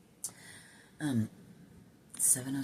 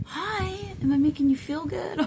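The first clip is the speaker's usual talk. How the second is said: high sing-song mock-cheerful greeting, growing louder; mock cheery; 6 dB louder than usual. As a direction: put on an overly perky, high voice. Mock cheery check-in after doom.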